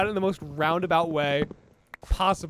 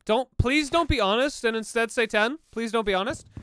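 mock pleading; 5 semitones higher, 4 dB louder than usual. Begging chat for a ten.

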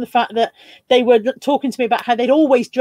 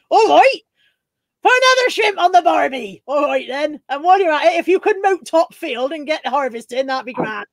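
mock Bristol-Somerset accent; 3 semitones higher than usual. Mocking co-host's Australian attempt, broad West Country accent. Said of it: "That was more Bristol and Somerset".

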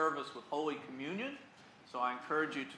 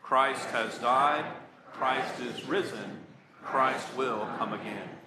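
slow, weighty proclamation; slow acclamation; 5 dB louder, slower than usual. Real, not acted. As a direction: declare each line slowly.

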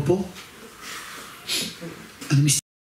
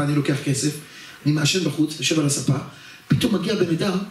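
ordinary delivery

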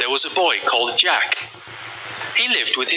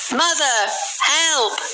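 much higher, calling for help; boy shouting; 12 semitones higher, slower than usual. Acted. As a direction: call out like a scared boy. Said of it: "Jack shouted".

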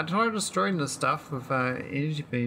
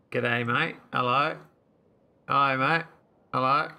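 hammed-up Aussie greetings with rising endings; Australian accent; 4 dB louder than usual. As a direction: play at a loud Australian hello. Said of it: "Ask the Aussies where the ancient hello went".

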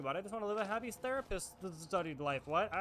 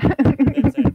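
insistent; 6 semitones higher, 19 dB louder than usual. Real, not acted. Begging partner for sign-off.

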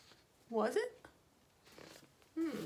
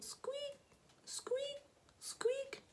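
high, soft little squeaks; squeaking noise; 6 dB quieter, much slower than usual. A narrator's reading. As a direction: pipe each squeak way up high. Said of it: "A squeaking noise".

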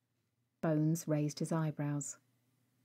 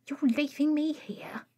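higher, aghast question; shocked disbelief; 8 semitones higher, 3 dB louder than usual. Acted. Rag left alone on the roof, shocked. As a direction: gasp it out in disbelief.

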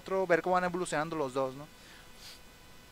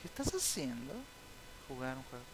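ordinary talk

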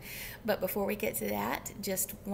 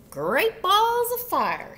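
high, loud, drawn-out exclamation; big storybook goose outburst; goose's excited cry; 10 semitones higher, 11 dB louder, slower than usual. A narrator's reading. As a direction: holler it slowly in amazement. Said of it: "she cried".

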